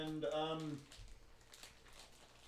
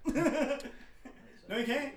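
laughing; 9 semitones higher, 5 dB louder, much slower than usual. Retold or imitated. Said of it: "cockroaches be like".

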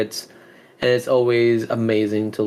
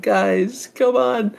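exasperated; 10 semitones higher, much slower than usual. Retold or imitated. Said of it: "And I'm like".

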